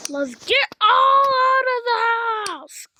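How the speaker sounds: frantic scream to get out; panicked shout. Real, not acted. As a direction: yell everyone out in a panic. Screaming to leave the house.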